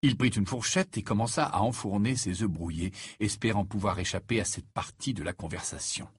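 usual speaking voice

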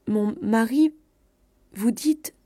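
halting, broken question; stunned stammering. A narrator's reading. Wife stammering at her cousin's bad news, stunned. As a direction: falter between the words, not yet understanding. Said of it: "Elle bégayait".

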